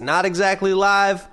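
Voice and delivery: normal talking voice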